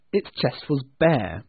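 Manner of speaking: ordinary delivery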